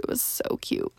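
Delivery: repeated as a breathy whisper. Real, not acted. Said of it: "and it was so cute".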